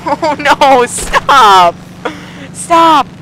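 high, loud cries of protest; panicked shout. Real, not acted. Gamer being shot at by enemy plane, panicked shout. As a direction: call out in real alarm. Under fire in the game.

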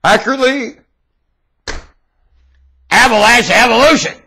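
high, loud fervent preaching. Acted. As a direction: proclaim it like a revival preacher.

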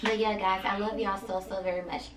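normal talking voice

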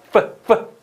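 teacher's exaggerated F in 뿌, 뿌; F sound demo. Shown as a demonstration. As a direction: puff out 뿌 as an isolated F. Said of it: "윗니가 아랫입술을".

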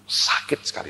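whispered in pain. Real, not acted. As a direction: breathe the words out, no voice, hurting. Telling of his deep hurt. (berbisik menahan sakit hati)

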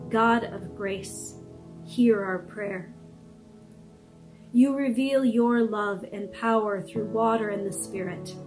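ordinary talk

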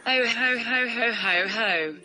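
hammed-up villain chuckle; evil laugh. Evil computer gloating at the user, mock evil laugh. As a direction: cackle like a cartoon baddie.

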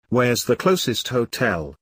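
usual speaking voice

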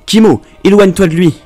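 high, loud frantic shout; desperate cry. Acted. Mother screams at her son to flee, desperate shout. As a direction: scream at your child to get away. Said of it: "cria-t-elle désespérément".

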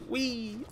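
high-pitched "whee"; playful whee. Real, not acted. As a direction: squeal a long, high whee. Playful cry while flying in game.

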